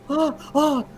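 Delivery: high-pitched imitated gasps; mock horrified gasps. Imitating friends shocked by the prank, high horrified gasps. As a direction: gasp "oh" twice in pretend shock. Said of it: "everybody was like".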